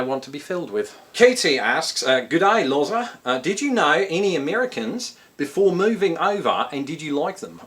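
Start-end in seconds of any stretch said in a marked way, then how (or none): 2.2-7.6 put-on light Australian accent. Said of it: "I did an Australian accent there".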